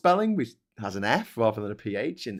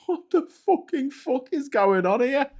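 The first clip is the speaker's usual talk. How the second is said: bewildered disbelief. Retold or imitated. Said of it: "just going".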